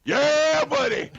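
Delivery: high, loud whoop of "yeah buddy"; full-volume, high-pitched holler; exuberant shout. Real, not acted. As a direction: yell it out as a rowdy cheer. Excited cheer at audience's joke.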